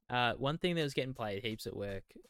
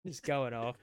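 usual speaking voice

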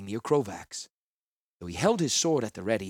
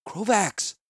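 a gentler call, still high and a bit loud; more gently; 11 semitones higher, 5 dB louder than usual. A narrator's reading. Healer calls Krovax again, trying to be gentler. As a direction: soften the call but keep it reaching him. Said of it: "I said more gently".

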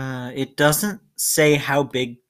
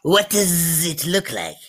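slow, drawn-out insect voice with buzzing z; wasp character voice; 3 semitones higher, slower than usual. Acted. Game master answers as the giant wasp, slow buzzy character voice. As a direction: play a wasp, stretching the words out slowly. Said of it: "this wasp who all have this exact same fucking".

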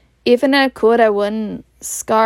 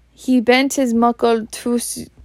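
usual speaking voice

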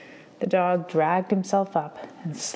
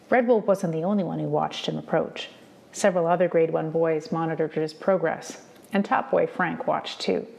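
ordinary delivery

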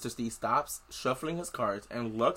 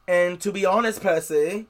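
higher, louder put-on voice for Chiron; character voice; 5 semitones higher, 7 dB louder than usual. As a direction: raise the voice into a character, then crack up.

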